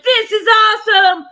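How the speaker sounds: loud, high superstar elation; high-pitched giddy holler; excited. Retold or imitated. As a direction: shout it out, thrilled with yourself. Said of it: "I was like".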